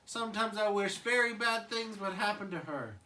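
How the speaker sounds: put-on angry baby Tommy. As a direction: grumble like a mad little kid. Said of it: "Tommy's like".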